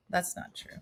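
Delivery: under the breath, to herself; whispered aside. Real, not acted. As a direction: whisper it as a quick confession.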